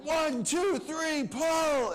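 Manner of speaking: high, loud shouted count to pull. Retold or imitated. Team hauling the anaconda up, shouting the count loud. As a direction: call the count to heave together.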